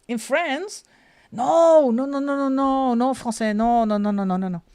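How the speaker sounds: high, loud protest tumbling into repeated noes; emphatic refusal. Real, not acted. Traveller turns down France for England, high emphatic refusal. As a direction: reject it outright, pitch jumping up.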